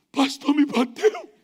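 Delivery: slow, high mock whimper; hammed-up victim whine; whiny complaint. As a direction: tattle like a beaten kid. Beaten man complaining to wife.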